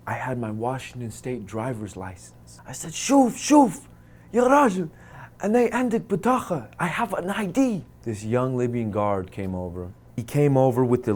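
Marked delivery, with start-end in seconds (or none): 2.9-7.8 frantic pleading. Retold or imitated. A frightened prisoner begs the guard to look. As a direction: plead fast to be believed. Desperate prisoner shows his license.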